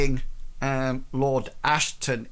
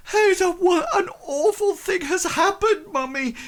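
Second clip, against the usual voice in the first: very high, loud overwrought lament; hammed-up wailing; 4 dB louder than usual. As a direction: wail it like a drama queen.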